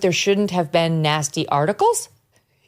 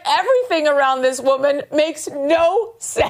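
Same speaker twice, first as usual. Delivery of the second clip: incredulous; 8 semitones higher, slower than usual. Real, not acted. Incredulous over her gaffe.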